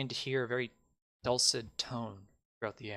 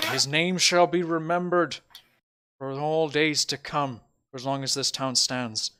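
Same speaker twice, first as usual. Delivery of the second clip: raised, stately public-speech voice; mayor giving a eulogy; 2 semitones higher, 6 dB louder than usual. Acted. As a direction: honour the fallen before a crowd, measured. Mayor's eulogy to the festival crowd.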